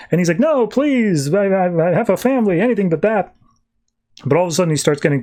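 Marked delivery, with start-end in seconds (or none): 0.4-3.3 high, stammering comic plea; mock desperate pleading. Exiled man begs the council, mock desperate. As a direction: beg against the sentence, pitched up. Exiled character begging not to go.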